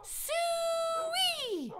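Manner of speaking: very high, drawn-out farm pig call. Shown as a demonstration. Calling a pig on the farm, very high and drawn out. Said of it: "The way that you call a pig on a farm is by saying".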